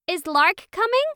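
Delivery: put-on high, loud eager kid's question; excited child. A narrator's reading. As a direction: ask it bouncing with excitement. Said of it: "Ollie jumped up and down".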